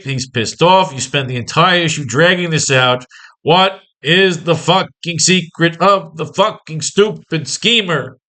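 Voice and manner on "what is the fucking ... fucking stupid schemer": slow, word-by-word exasperation; exasperated. Acted. Reader sick of the story dragging out, exasperated. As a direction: pound out each word slowly in mock frustration.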